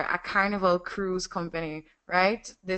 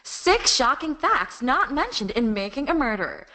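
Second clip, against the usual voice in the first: higher, punchy American promo read; American accent; 3 semitones higher than usual. Shown as a demonstration. Voice actor demoing a promo, higher American announcer accent. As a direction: tease the true-crime facts in an American announcer accent. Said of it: "the American accent".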